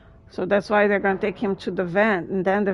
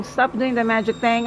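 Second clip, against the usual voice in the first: ordinary talk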